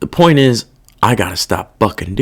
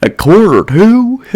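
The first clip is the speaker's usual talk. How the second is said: slow, higher mock repeat of the guard; a scornful, incredulous echo of the guard's words; 8 semitones higher, 3 dB louder, slower than usual. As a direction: drag it out to make fun of him. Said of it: "That's what he said".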